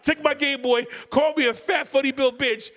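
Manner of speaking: high, indignant complaint; high outraged child's protest; indignant kid outburst. Retold or imitated. Kid yelling at dad.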